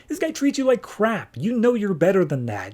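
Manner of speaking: high-pitched acted protest; exasperated urging. Wonder Woman argues Orion deserves better, exasperated urging. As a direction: imitate an exasperated friend arguing.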